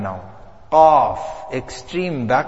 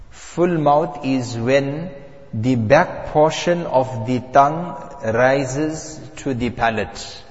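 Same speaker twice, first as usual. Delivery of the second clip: usual speaking voice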